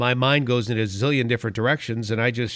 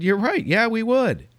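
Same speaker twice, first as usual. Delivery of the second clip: eager agreement; 10 semitones higher than usual. Retold or imitated. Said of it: "And I said".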